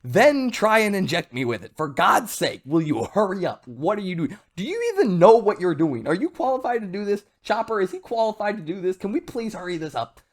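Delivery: high-pitched, exasperated complaining; fed up and hurrying the helpers along; impatient Zoro. Acted. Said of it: "Zoro is just so impatient".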